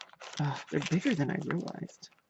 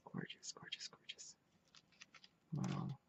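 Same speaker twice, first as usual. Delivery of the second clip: whisper; 15 dB quieter than usual. Real, not acted. Admiring the applique.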